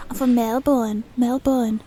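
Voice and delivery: forceful attempt at Australian; Australian accent. Shown as a demonstration. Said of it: "I can't do my Australian accent".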